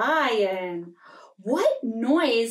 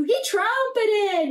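higher, slow drawn-out trumpeting call; elephant trumpet; 8 semitones higher, slower than usual. Shown as a demonstration. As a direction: stretch it like an elephant's trunk call. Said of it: "can you trumpet too?".

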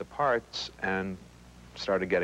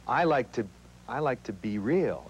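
ordinary talk, nothing marked

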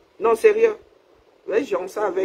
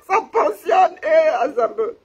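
overwhelmed laughing cry; 8 semitones higher, 4 dB louder, slower than usual. Real, not acted. Overwhelmed by gifts on stream.

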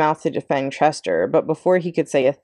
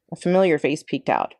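plain reading voice

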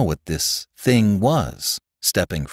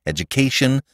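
usual speaking voice

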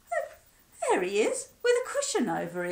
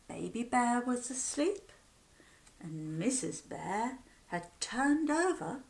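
plain reading voice

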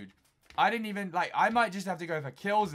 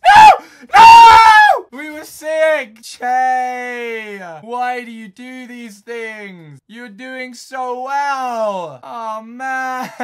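huge, much higher shout of real dismay, drawn out slowly; anguished scream; 8 semitones higher, 21 dB louder, much slower than usual. Real, not acted. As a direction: wail at losing everything. Loses base, screams in despair.

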